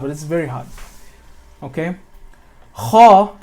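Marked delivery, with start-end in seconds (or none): 2.7-3.4 kha said long with a full ah; correct thick kha. Shown as a demonstration. Tutor repeating the correct sound, thick full kha. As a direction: fill the mouth on kha. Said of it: "is the correct way to pronounce it".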